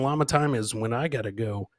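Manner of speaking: ordinary talk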